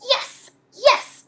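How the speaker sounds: high, eager yes yes; excited shout. Acted. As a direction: shout it gleefully. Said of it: "shouted Grump".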